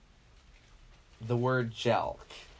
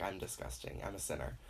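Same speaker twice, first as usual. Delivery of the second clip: mock self-loathing murmur; 3 semitones lower, 9 dB quieter, faster than usual. Guilt after orgasm, self-loathing.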